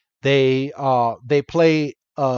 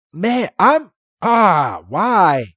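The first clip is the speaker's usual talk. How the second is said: pitched-up, frustrated groan of protest; exasperated lament; 4 semitones higher, 4 dB louder, much slower than usual. Real, not acted. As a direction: plead in exasperation at the unfairness. Lamenting an episode not released here.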